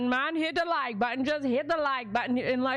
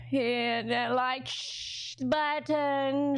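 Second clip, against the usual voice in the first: put-on Jamaican accent; 2 semitones higher, much slower than usual. Doing the Jamaica version for viewers. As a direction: drawl it out Jamaican-style. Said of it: "She did the Jamaica version".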